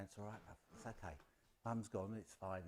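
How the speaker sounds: very soft, gentle reassurance to upset nurses; soothing the staff softly; reassuring. Retold or imitated. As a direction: hush them kindly, almost under the breath. Comforting the hospital staff.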